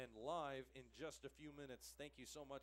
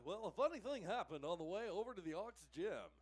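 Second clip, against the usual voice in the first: put-on higher, louder old-time radio announcer; 5 semitones higher, 7 dB louder than usual. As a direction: boom it out like a radio host. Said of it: "I'll put on my radio voice".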